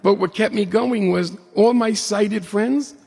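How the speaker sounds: high, laughing through the words. Real, not acted. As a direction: giggle as you tell it. Laughs at sighted friends.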